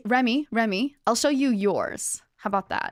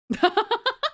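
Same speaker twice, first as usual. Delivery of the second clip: high giggle; 12 semitones higher, 4 dB louder than usual. Real, not acted. Laugh after showing emote.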